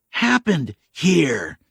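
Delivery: very slow, high, pressing; slow demand. Acted. K J pressing the stranger, slow. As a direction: drag out each word of the demand.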